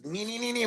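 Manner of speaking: high, soft motor whine; small high-pitched engine noise; imitated chainsaw revving. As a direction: mimic a chainsaw revving in short repeats. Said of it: "Nate goes out there and does".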